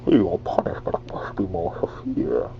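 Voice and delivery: whispered masked villain; Bane. Shown as a demonstration. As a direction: breathe out a villain's threat. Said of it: "Try sounding like Bane".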